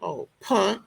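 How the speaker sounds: ordinary delivery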